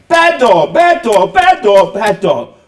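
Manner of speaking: put-on high, loud little girl's scolding; a little girl punishing her doll, each "bad" hit hard; child scolding doll.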